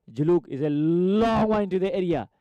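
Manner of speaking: football commentator drawing out the word long; excited commentary. Real, not acted. Long ball played into the area.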